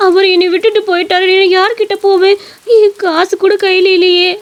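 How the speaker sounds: high, distressed wife voice; pleading, despairing wife. Acted. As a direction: beg him to stay, then lament helplessly. Wife begs, then despairs. (பரிதவித்து கெஞ்சும் மனைவி)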